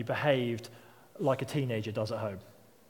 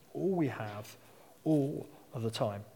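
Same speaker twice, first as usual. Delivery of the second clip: "All" repeated softly, slowed and softer; quieter echo; 6 dB quieter than usual. Shown as a demonstration. Preacher repeats the phrase to the congregation, softer echo. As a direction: let the repeat sink in, softer. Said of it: "He asks us to respond with all we have".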